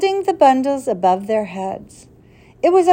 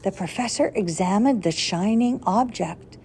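ordinary delivery, nothing marked

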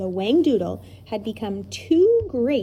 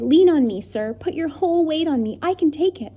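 eager offer; 6 semitones higher, 4 dB louder than usual. Acted. Tom offers support.

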